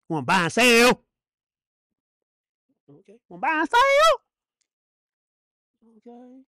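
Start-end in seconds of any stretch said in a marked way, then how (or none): 0.1-1.0 loud challenge at usual pitch; taunting preacher. Acted. Repeats the taunt, lower take. 3.3-4.2 very high, loud mocking question; squealed preacher's taunt; high-pitched taunt. Repeats the taunt, higher take.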